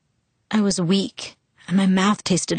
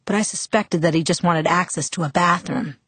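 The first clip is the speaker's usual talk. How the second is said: ordinary delivery, nothing marked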